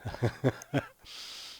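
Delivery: breathy burst of laughter; real laugh. Real, not acted. Joke teller after his punchline, laughing. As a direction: crack up at your own joke.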